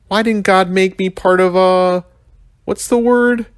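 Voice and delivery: high put-on young rhino voice, searching for a word; whiny kid rhino groping for the word; Mac the rhino muttering. A narrator's reading. Rhino complaining to the narrator, high and whiny. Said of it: "Mac murmured".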